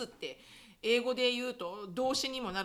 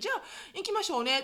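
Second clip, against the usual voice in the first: a light hint of Okinawan lilt, higher and quick; Okinawan dialect; 9 semitones higher, faster than usual. Tokyo speaker suddenly sounding Okinawan, light Okinawan lilt. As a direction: lightly slip into Okinawan speech. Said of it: "すっごい沖縄じゃん".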